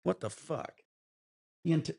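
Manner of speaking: ordinary talk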